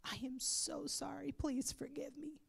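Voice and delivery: soft voiceless whisper of repentance; whispered apology. Retold or imitated. Retelling her prayer of repentance, whispering sorry to Jesus. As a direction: hush it like a confession. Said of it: "And I immediately said".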